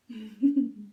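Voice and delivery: short snickering laugh at Bubba; mocking laugh. Stepbrother laughing at Bubba's chores, mocking snicker. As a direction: laugh down at him, smug.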